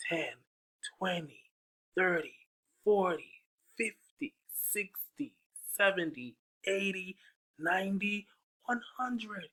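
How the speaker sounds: high storytime counting; counting to children. Acted. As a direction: count up to a hundred with the kids. Skip counting by tens to children.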